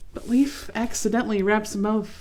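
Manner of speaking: ordinary delivery, nothing marked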